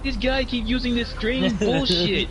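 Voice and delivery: high, whining mock complaint; whiny opponent. Mocking the losing opponent, high and whiny. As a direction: squeak it out like a sore loser.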